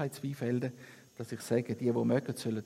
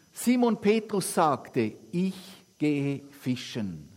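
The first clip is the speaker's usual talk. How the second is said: lively, lightly acted Bible reading to the congregation; scripture reading; 2 semitones higher, 5 dB louder than usual. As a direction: read the Gospel aloud with the quotes lightly voiced. Reads Bible passage aloud. (lebhafte Bibellesung)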